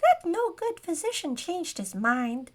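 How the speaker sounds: high, squeaky grumble; grousing gnome. A narrator's reading. Gnome complaining about the first buyer, grousing squeakily. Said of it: "the gnome groused".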